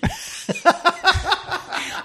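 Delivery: high breathy laugh. Real, not acted. Laughing retelling the couple's story.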